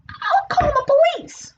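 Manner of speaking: very high, slow little character voice; alarmed turtle character. Acted.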